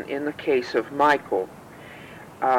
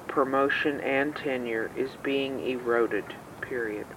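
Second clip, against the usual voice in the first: dictating; 5 dB quieter than usual. Real, not acted. Dictating a letter.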